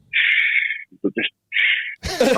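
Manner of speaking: hushed dismissive shush. Retold or imitated. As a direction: shush her like a child. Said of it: "I'm like".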